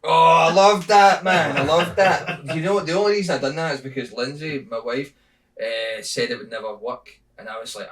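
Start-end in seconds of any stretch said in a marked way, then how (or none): 0.0-2.4 high, loud fond exclaim; light up remembering it; delighted. Real, not acted. Comedian delighted his old bit is remembered.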